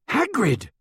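sudden high bellowed name. A narrator's reading. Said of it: "Harry bellowed".

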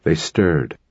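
plain reading voice

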